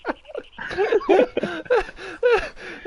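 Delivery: long helpless high-pitched laughing fit; can't stop laughing, very high; high laughter. Real, not acted. Host cracking up at the duck voice, high helpless laughter.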